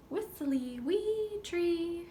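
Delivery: high, slow whistly bird song; whistled starling tune. Shown as a demonstration. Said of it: "Starling sings from a metal pole".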